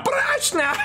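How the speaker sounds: very high shriek breaking into cackles; laughing satyrs. Acted. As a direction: screech satyr gibberish, then cackle. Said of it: "And they're laughing".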